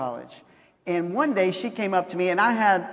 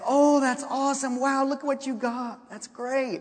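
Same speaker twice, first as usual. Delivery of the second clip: excited cheering; 6 semitones higher than usual. Retold or imitated. Family cheering each present.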